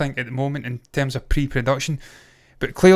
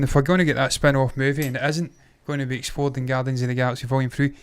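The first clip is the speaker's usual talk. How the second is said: normal talking voice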